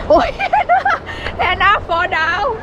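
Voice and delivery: high squeaky laugh talk. Real, not acted. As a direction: laugh through the words. Laughing at dog.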